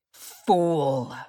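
drawn-out contemptuous snarl; controlled fury on one word. A narrator's reading. Warrior insulting the departing hobbit, snarled. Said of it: "Boromir snarled".

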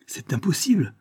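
high-pitched exclamation of disbelief; startled protest at normal volume; crying out. A narrator's reading. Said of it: "s'écria-t-il".

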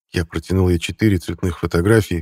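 plain reading voice

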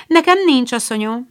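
high, bright quick answer; a lightly voiced young girl; eager reply. A narrator's reading.

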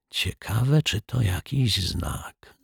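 soft, drawn-out wondering; hushed musing. A narrator's reading. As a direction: wonder aloud very softly and slowly. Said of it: "szepnął detektyw Pozytywka". (ciche, przeciągłe zamyślenie)

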